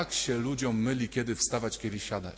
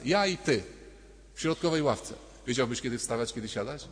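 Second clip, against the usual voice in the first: normal talking voice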